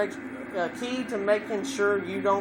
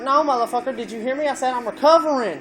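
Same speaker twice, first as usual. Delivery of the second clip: loud and higher, snapping back at his friend; raised voice; 7 semitones higher, 7 dB louder than usual. Retold or imitated. Sick at a party, yelling at Andy that he's recovering. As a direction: raise your voice, fed up at being asked again. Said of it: "when I got, raised my voice".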